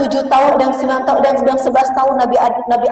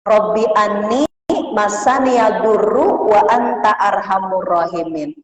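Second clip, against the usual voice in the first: slow recitation; slower than usual. Shown as a demonstration. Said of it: "Kalau pengen tahu doanya itu".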